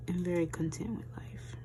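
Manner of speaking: soft breathy whisper. Real, not acted. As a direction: whisper it contentedly. Soft close of thought.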